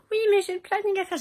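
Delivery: high, fast whiny quote; imitating colleague. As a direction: squeak like a fussing coworker. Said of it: "Marion m'a dit". (voix aiguë imitée, plaintive)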